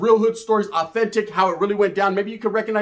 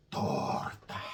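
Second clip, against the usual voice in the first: slow motion; 14 dB quieter than usual. Shown as a demonstration. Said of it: "in slow motion".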